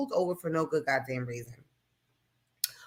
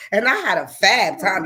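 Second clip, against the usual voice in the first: ordinary delivery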